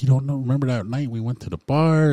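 retold amazement. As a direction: imitate a friend who can't believe you forgot. Cousin amazed he remembers nothing.

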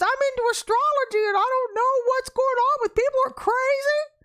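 high, agitated imitation of a barista; upset young lady. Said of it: "all upset".

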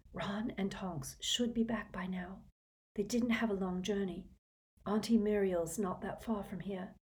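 soft, worried whisper; low voice. Acted. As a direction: keep it low and anxious. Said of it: "she said in a low voice".

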